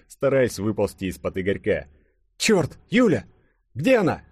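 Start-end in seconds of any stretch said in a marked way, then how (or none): 2.4-4.2 high, louder urgent question; alarmed outcry. A narrator's reading. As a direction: suddenly panic about a missing friend. Sudden alarm for missing Юля. (встревоженный возглас, высоко)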